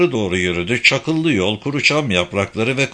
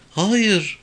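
put-on high girl's voice; young girl; 9 semitones higher than usual. A narrator's reading. Girl answers police questioning, high young voice.

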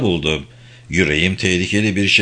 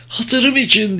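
much higher, pleading cry; begging; 13 semitones higher, slower than usual. A narrator's reading. Maud to Humphrey, begging him to spare Larsen: high plea. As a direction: plead for his mercy. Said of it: "diye yalvardı".

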